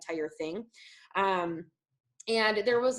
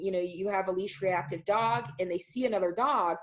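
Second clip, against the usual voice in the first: ordinary talk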